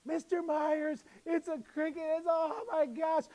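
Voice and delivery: high-pitched imitation of panicking kids; hammed-up alarm, pitched high; mock freaking out. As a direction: squeal like students freaking out over a bug. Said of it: "they were freaking out".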